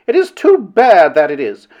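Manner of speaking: aggrieved, emphatic grumble; indignant complaint. A narrator's reading. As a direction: stretch the grievance out, then drop. Aggrieved protest before the tears.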